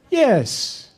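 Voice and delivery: imitating a director's emphatic, high-pitched yes; Bernardo Bertolucci. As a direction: answer high and firm, as the director.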